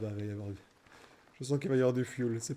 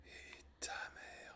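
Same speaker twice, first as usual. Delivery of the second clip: whispering; 18 dB quieter, slower than usual. Real, not acted. Whispered insult.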